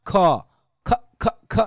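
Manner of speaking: "খ" as short aspirated bursts; IPA sound demo. Shown as a demonstration. Teacher shows how C usually sounds, aspirated bursts. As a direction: puff out "খ" repeatedly, each separate. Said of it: "এরকম উচ্চারণ হয়".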